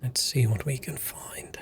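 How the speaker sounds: sneaky whisper while searching; whispered aside to the audience; playful whisper. Pretend. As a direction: hush it like you're sneaking around. Player whispering while exploring.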